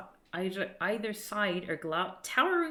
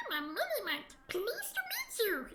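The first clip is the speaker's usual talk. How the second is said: high, soft squeaky greeting, slowing down; a little sprite bowing politely; polite tiny creature; 13 semitones higher, 4 dB quieter than usual. Acted.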